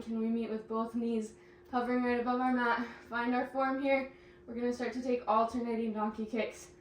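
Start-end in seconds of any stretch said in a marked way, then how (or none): none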